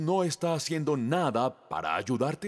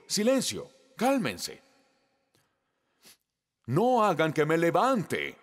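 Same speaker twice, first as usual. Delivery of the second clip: papá regañando; 4 semitones higher than usual. Retold or imitated. Padre calla a sus hijos.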